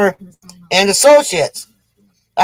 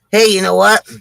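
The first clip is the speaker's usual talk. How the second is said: ordinary delivery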